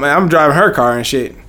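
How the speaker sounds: ordinary talk, nothing marked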